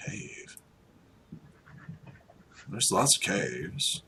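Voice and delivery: quiet puzzled query, then slow doubt; slow, sceptical farmer; frowning doubt. Acted. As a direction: mull it over with a frown. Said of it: "He frowns at this".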